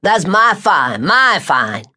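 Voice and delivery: a hint of an old man's relish; delighted old man. Shady Green savoring the song, slow and pleased. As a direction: savor each my fine slowly.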